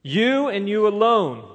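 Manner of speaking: high, loud, slow stress on "You and you alone"; emphatic you. Acted. Preacher driving home the emphatic pronoun, stressed. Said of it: "what we would call an emphatic pronoun".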